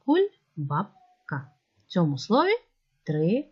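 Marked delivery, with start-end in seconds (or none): 0.1-1.5 Куль, баб, ка as three separate beats; syllable by syllable. Shown as a demonstration. Teacher recalling the syllable division, word in separated pieces. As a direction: pause after each of Куль, баб, ка. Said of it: "поділили це слово на склади так".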